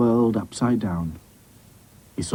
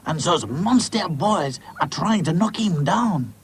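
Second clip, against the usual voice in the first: put-on higher, slower French-accented voice; 7 semitones higher, slower than usual. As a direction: report the danger with a French accent. French doll reports danger.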